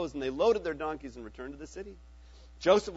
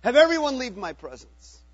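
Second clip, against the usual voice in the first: loud, higher command to clear the room; cried out; 7 semitones higher, 11 dB louder than usual. Acted. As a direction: call out to send everyone away. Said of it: "and he cried".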